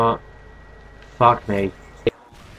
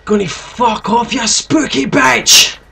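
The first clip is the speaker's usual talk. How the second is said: raging gamer; 8 semitones higher than usual. Real, not acted. Yelling at enemy.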